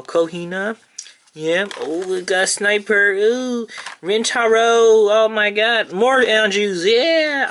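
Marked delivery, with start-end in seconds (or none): 1.8-7.5 higher and louder, thrilled cries; excited gushing. Real, not acted. Fan flips through cards of his favourite girl, excited gushing. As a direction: gush over each card like a happy fan.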